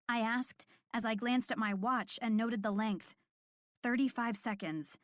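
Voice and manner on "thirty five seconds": ordinary delivery, nothing marked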